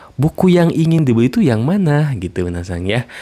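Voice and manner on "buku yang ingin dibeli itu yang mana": lively asking voice. Shown as a demonstration. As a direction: ask the customer which book brightly. Translated model question.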